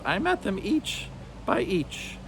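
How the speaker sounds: a lightly voiced sly witch hissing; hissing hag. A narrator's reading. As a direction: hiss it with narrowed menace. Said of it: "the beldame hissed".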